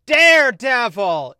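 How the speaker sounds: loud, slow shout of Daredevil. Retold or imitated. Executives insisting on Daredevil, shouted loud and slow. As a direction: bellow the name. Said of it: "Even louder".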